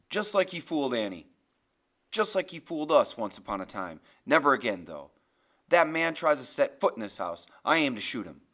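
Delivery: loud, forceful, resolute husband; grim vow. Acted. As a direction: argue hard, then lay down the threat. Grim vows to defend the house.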